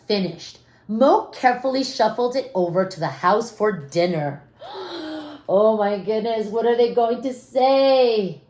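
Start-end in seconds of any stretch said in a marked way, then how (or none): 4.7-5.4 gasp. A narrator's reading. Narrator gasps at suspense.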